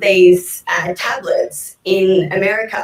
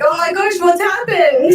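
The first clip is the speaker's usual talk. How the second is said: high-pitched, startled outburst; shocked dad; 9 semitones higher than usual. Retold or imitated. Dad sees the daughter coated in fake tan, shocked. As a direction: exclaim it high, like an alarmed father. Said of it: "my dad looking at me shocked".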